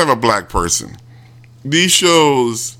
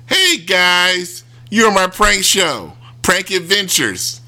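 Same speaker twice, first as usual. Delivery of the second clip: mock cheery host; 6 semitones higher, slower than usual. Kid host reveal.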